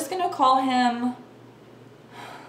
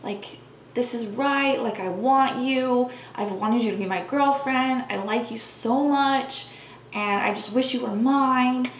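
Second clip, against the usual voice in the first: a lightly voiced, slow, hushing coax; imitating his coaxing hush; 3 semitones higher, slower than usual. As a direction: mimic him soothing her into giving in, only lightly put on. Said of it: "doing that whole, like".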